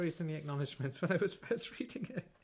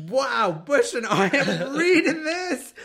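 loud, slow words through laughter; laughing disbelief; 8 semitones higher, 10 dB louder, much slower than usual. Retold or imitated. Reacting to the racy chapter, laughing loudly. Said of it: "I was like".

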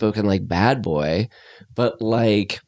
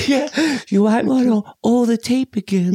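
playful falsetto; 12 semitones higher than usual. Pretend. Playful mock director line.

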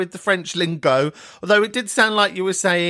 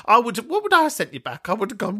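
usual speaking voice

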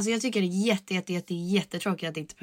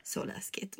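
whispered; whispered fear; 11 dB quieter, slower than usual. Real, not acted. Answering the sister about nerves, whispered. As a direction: breathe it out without voice. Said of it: "Hur känns det då?".

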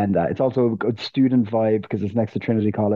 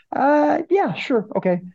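much higher-pitched imitation of an eager yes; imitated American girl; slower than usual. American tourist answering a comedy-club promoter, imitated high and agreeable. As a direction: put on a high voice for a too-ready agreement.

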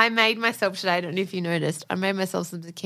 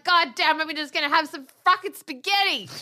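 higher, retold exasperated declaration; defiant resolve; 7 semitones higher than usual. Host retelling the spaghetti decision, defiant. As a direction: proclaim it with mock exasperation. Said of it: "So now I was like".

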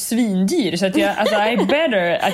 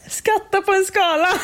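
mocking echo; 9 semitones higher than usual. Mocking friend's odd phrase.

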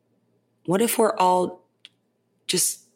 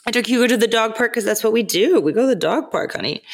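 higher, louder and quicker, sassy; 7 semitones higher, 4 dB louder, faster than usual. Real, not acted. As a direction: toss it off with sass. Sassy storytelling flourish.

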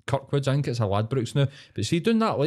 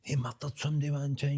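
put-on spooky kid rumour; 3 semitones higher, 8 dB quieter than usual. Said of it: "you'd be like, pure".

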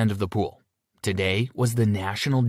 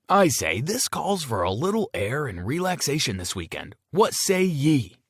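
jovial coach dad; 6 semitones higher than usual. A narrator's reading. Coach dad proposing a weekend trip to the team. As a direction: play it like a pompous old sea captain. Coach dad's hearty old-fashioned announcement.

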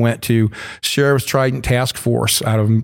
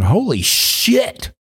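high, very slow amazed exclamation; retold astonishment; 10 semitones higher, much slower than usual. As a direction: gasp it out at the sight of the safes. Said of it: "I'm hearing".

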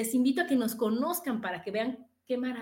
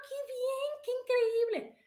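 a much higher voice saying "qué increíble"; imitating the New York salesman's delight; 13 semitones higher than usual. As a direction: mimic the thrilled shop clerk up high.

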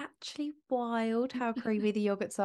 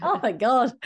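surprised; 6 semitones higher, 4 dB louder than usual. Retold or imitated. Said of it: "I was like".

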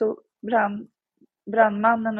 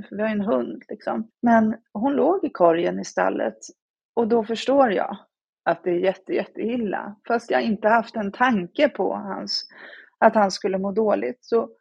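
ordinary talk, nothing marked

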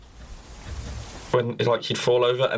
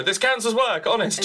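mock streamer yell; 10 semitones higher than usual. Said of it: "shout and scream and go".

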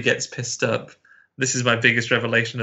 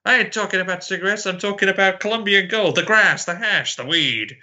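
higher, hammed-up cop listing slang; over-the-top policeman; 8 semitones higher than usual. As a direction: play up the caricature cop. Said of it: "And he's like".

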